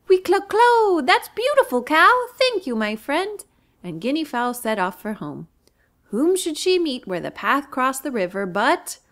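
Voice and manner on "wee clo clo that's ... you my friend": very high loud joyful cluck; delighted bird. Acted. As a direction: burst with happiness. Guinea fowl thrilled with spots.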